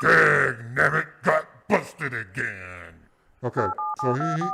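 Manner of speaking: loud annoyed exclamation; frustrated outburst. Real, not acted. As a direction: blurt it out, fed up. Call hung up on him.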